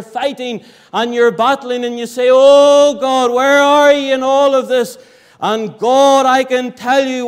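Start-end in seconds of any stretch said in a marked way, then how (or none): 2.3-5.0 loud, pleading cry to God; desperate cry. Retold or imitated. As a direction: cry out from the end of your rope. Voicing a desperate prayer.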